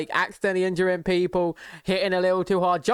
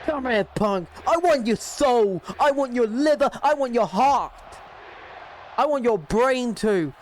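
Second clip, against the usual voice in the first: wrestler's taunt; 4 semitones higher than usual. Pretend. Plays menacing wrestler taunting Punk.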